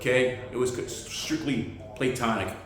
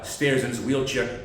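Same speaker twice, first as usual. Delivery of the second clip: ordinary talk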